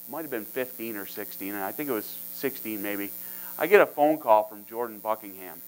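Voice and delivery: normal talking voice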